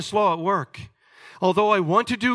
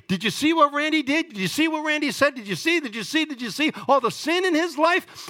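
high, gossiping accuser; accusing devil; 7 semitones higher than usual. Retold or imitated. The devil accusing Randy before the throne, mock tattling. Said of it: "the accuser of the brethren is accusing us".

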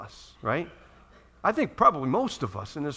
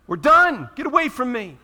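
sharp, much higher and louder dismissal; fed-up outburst; 8 semitones higher, 8 dB louder than usual. Acted. Preacher playing the fed-up friend, loudly dismissive. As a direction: snap it off, you've had enough. Fed-up person snapping back.

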